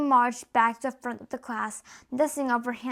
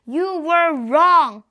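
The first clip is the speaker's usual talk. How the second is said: slow, much louder bark, pitched up; 4 semitones higher, 10 dB louder, much slower than usual. A narrator's reading. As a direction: slam each word down on the boy. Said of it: "the Trunchbull barked".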